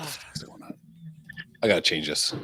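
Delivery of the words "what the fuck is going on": barely audible whisper; muttered aside. Real, not acted. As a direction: whisper it under your breath. Muttering over phone trouble.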